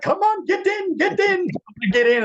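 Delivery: hammed-up cab driver waving him in; eager cab driver. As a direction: cabbie at the curb hurrying the late wrestler in, mock high voice. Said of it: "Habib's like".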